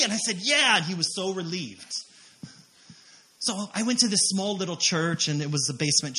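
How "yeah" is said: upbeat confirmation. Retold or imitated. Acted happy confirmation to friend.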